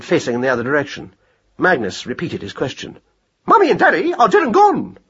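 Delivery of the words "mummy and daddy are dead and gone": high sing-song hare voice. Acted. Mad hare answers lost mouse in sing-song. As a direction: chant it lightly, pitched high. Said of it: "said in a sing-song voice".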